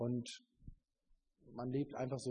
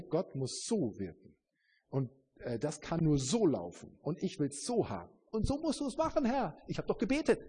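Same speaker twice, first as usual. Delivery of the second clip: mock demanding believer; 5 semitones higher, 4 dB louder than usual.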